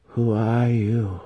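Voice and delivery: questioning spirit. A narrator's reading. Summoned spirit questions the wizard, slow ghostly voice. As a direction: drift through the words like a summoned spirit. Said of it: "it asked at length".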